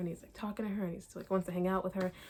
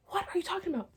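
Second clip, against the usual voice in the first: much higher, disbelieving question; incredulous outburst; 11 semitones higher, faster than usual. Real, not acted. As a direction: throw it at her in disbelief. Outraged at character's claim.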